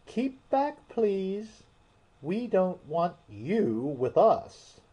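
squeaky pleading fish; whiting voice. Pretend. Alice voicing the whiting.

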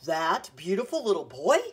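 loud, swooping disbelief; astonished question. A narrator's reading. Grandfather learning the boy is his own, astonished. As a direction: question it in happy amazement.

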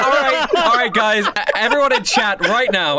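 rally the chat while laughing; excited laughing shout. Real, not acted.

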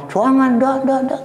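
very high, frantic protest; panicked mock refusal. Princess refusing the filth-covered man, comically panicked. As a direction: squeal the refusals in alarm.

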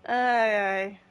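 normal talking voice

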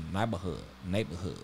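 imitating L.A. homies, low and hushed; L.A. homie accent. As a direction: drop low and soft in mock L.A. homie accent. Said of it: "they say like that".

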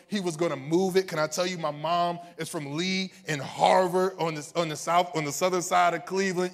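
loud, high, driving sermon delivery; full-force preaching. Real, not acted. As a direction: build the testimony at full volume to rouse them.

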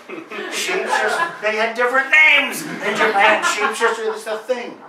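high, loud, cracking up while still in the accent; can't hold the voice for laughing; laughing through it. Real, not acted. Cracks up mid-impression.

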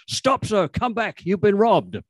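high, urgent call down the street. A narrator's reading. Constable calling a robbed pedestrian back, high urgent call. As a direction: hail him back, pitched high. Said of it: "a man who was calling out".

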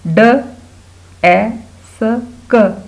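ड, ए, स, क as separate short sounds; sounding out letters. Shown as a demonstration. Teacher breaking desk into sounds, sounding out letters. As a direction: say ड, ए, स, क one by one with pauses. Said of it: "डेस्क में".

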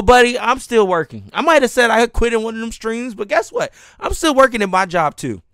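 ordinary delivery

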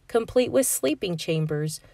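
plain reading voice

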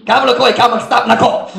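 laughing through the words, fast; real laugh under fast speech. Real, not acted. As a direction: chuckle while retelling. Laughing at the memory.

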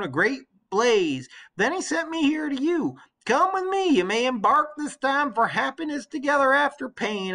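put-on an old servant bursting with good news; old nurse, excited. Old nurse telling her queen the suitors are dead, eager and high.

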